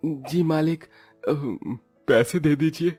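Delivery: nervous servant's small voice; timid pleading. Acted. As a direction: ask the boss meekly, faltering. Labourer timidly asks landlord for wages.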